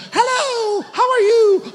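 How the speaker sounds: very high sugary princess voice. Retold or imitated. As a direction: squeal it like a theme-park princess. Mocking Disney princess greeting. (voz aguda de princesa)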